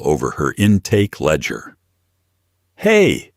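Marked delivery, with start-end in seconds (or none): 2.8-3.3 high, loud sharp "Hey!"; sharp snap. Acted. Naomi snaps at the man pouring cleaner, sharp. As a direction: snap it out to stop him. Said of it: "Naomi snapped".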